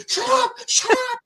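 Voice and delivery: very high, squealed mock plea; mortified nephew. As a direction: imitate a desperate embarrassed teenager. Mimics nephew begging uncle to stop.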